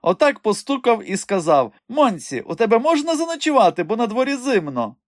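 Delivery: high, put-on mocking voice. A narrator's reading. Mocking the thief's excuse, all laugh.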